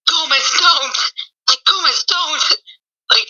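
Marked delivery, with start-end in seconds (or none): 0.1-1.0 a hint of Morticia, higher; lightly voiced calm warning; Morticia quote. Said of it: "She's like". 1.7-2.4 smooth calm warning, higher; lightly voiced Morticia; Morticia's calm voice. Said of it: "in her smooth but calm voice".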